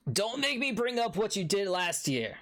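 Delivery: butter up the innkeeper while making a request; smooth town official. Acted. Official threatens the dwarf.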